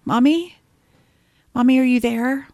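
put-on high, light little-girl voice calling out; child calling for mommy. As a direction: call out like a scared little girl looking for her mom. Reading daughter's frightened text aloud.

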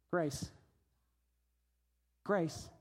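one soft, low word; quiet, merciful answer; gentle pronouncement. Retold or imitated. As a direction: drop to a hush after the fervour. Jesus answering the accusers.

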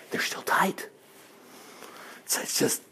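hushed, voiceless reveal; whisper. Real, not acted. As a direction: drop to a whisper as if sharing a secret. Answers "guess what" in whisper.

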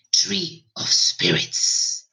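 slow, whispered and cracking; cracked whisper. Acted. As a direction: breathe it slowly, voice breaking. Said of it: "he said in a cracked voice".

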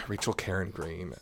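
low, soft repeat of the name; hushed after being loud. Real, not acted. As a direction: say it quietly, reined in. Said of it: "Sorry, I'm being loud".

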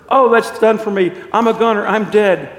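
loud, high cry of despair; acted dying lament; doomed wail. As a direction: cry out as if finished off. Ghost laments his death.

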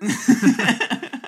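high, loud burst of laughter; big real laugh. Real, not acted. Laughing at the cassette joke.

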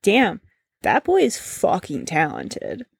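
usual speaking voice

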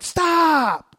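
sharp high yell; retold yell. Youth pastor yelling at the junior high boys, high and loud. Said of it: "But I'm like".

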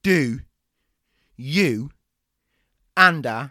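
each word spaced, loud final; emphatic. Acted. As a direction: hammer each word with pauses. Trainer hammers question.

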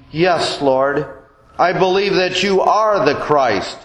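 ordinary talk

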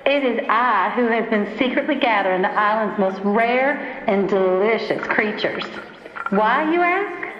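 gloating villain, savoring each word; movie villain monologue. Acted. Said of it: "she starts talking like a movie villain".

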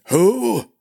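put-on high, loud, startled old man; a half-deaf knight's sharp query. A narrator's reading. Deaf old master asks.

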